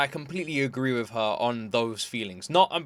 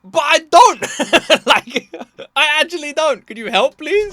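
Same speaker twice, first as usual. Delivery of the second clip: helpless pleading; 12 semitones higher, 10 dB louder than usual. Retold or imitated. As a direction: beg for help like a lost student. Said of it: "I'd be like".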